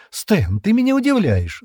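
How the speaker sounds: high, loud burst of surprise; astonished exclamation. A narrator's reading. Джемма to Стэн about his risky plan, exclaiming in surprise. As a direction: exclaim it with disbelief, pitch shooting up. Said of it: "воскликнула Джемма".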